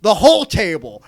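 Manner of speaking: a mock wrestler's roaring command; shouted demand. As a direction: bellow it at the ring announcer. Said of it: "he says".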